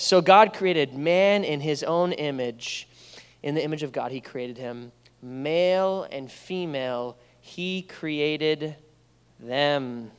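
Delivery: slow, deliberate reading from the Bible; scripture reading. Acted. Pastor reading Genesis to the church, deliberate and slow. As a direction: let each phrase land.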